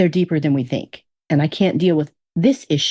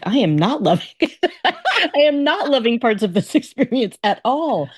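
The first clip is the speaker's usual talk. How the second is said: laughing protest; 7 semitones higher than usual. Retold or imitated. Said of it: "And I'm like".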